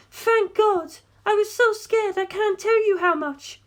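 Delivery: put-on high, relieved young woman's voice; tearful relief in a high female voice. A narrator's reading. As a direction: let the relief pour out of her. Darlington relieved in tears.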